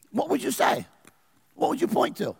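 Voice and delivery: high insistent challenge to the congregation; pressing question. Acted. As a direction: push them for an answer.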